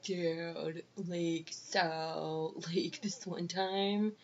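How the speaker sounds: slow, low-energy dude drawl; soft, dragged-out bro storytelling voice; dude-bro type. Retold or imitated. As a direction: imitate a laid-back bro, slowly. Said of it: "he was, like, one of those".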